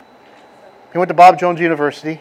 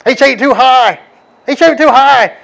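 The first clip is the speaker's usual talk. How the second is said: much higher, louder squeaky voice imitating a toothless cousin; high-pitched cousin impression; 10 semitones higher, 8 dB louder than usual. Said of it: "He just does this high pitch".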